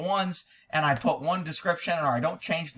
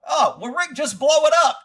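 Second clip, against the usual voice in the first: mock viewer; 9 semitones higher, 7 dB louder than usual. Imagined viewer reply.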